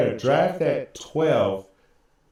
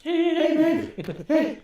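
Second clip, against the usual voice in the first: pleading; 12 semitones higher, slower than usual. Retold or imitated. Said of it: "And here I am".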